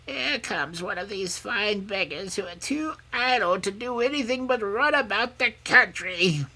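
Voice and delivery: put-on high-pitched, grim old crone; grim old woman. A narrator's reading. Old woman eyeing the approaching princess, grimly. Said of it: "who said grimly".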